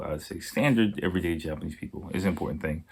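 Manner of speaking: ordinary delivery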